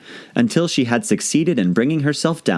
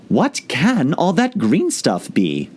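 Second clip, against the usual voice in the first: put-on high, slow wondering girl voice; lightly voiced curious Alice; puzzled Alice; 7 semitones higher, slower than usual. Alice staring at the leaves below, puzzled. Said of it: "said Alice".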